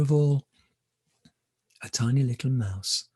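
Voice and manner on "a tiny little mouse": ordinary delivery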